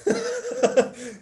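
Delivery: high, slow, laughing with relief; patient laughing as the bump turns out to be bone; relieved laugh. Acted. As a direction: laugh it out, the fear gone.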